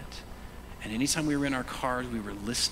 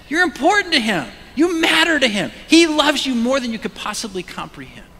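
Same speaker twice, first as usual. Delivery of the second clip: shouting; 13 semitones higher, 12 dB louder than usual. Real, not acted. Preacher shouting encouragement.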